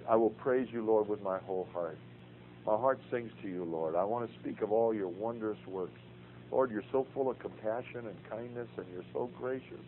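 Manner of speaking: soft, hushed reading of a psalm as prayer. Shown as a demonstration. Teacher shows class how he prays the Psalms, hushed. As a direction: pray the psalm quietly to the Lord. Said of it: "some call it pray reading".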